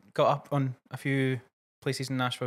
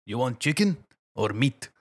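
put-on slow, heavy Eastern European accent on every word; Soviet accent; 3 dB louder, slower than usual. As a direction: imitate the kebab guy's Soviet accent, slowly. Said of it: "he had a Soviet tinge to him".

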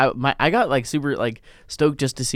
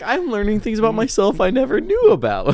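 high, drawn-up mimicking voice; mock imitation; 8 semitones higher than usual. Teasing a co-host about learning on the podcast, high mock imitation. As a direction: parrot the co-host in a raised pitch. Mocking imitation of co-host's remark.

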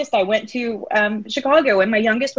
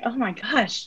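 shaky sigh; 6 dB quieter than usual. Real, not acted. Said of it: "Breathe through your nose".

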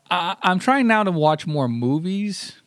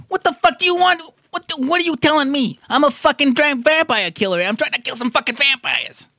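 angry vampire hunter; 10 semitones higher than usual. Acted. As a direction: rant like a fed-up vampire killer. Voicing the game's angry hero.

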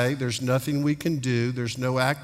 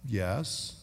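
put-on small, soft, lower-pitched child's reply; imitated child's answer; 5 semitones lower, 8 dB quieter than usual. Child answering Daddy in bed at night, quietly dutiful. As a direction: imitate a little one answering quietly by rote.

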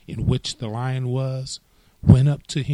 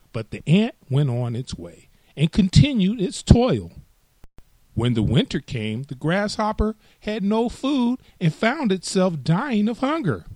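plain reading voice

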